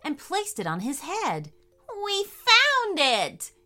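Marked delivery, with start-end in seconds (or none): 2.0-3.5 slow, high and loud cheer; excited cry. Acted. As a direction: shout the discovery with joy. Finding treasure joy.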